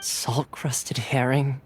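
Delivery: slow, unvoiced, queasy whisper; a slow sick whisper to himself; dazed whisper. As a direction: barely breathe the words out, sick. Thoma dazed listing what he ate.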